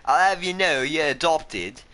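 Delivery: "I'll have you know" in a fake upper-class accent; mock posh British. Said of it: "Yes, I'm British, love".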